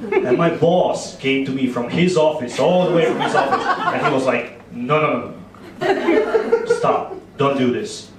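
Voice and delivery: ordinary talk, nothing marked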